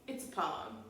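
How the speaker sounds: ordinary talk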